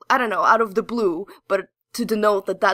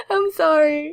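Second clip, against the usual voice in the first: laughing; 7 semitones higher, 3 dB louder, slower than usual. Real, not acted. Laughing apology.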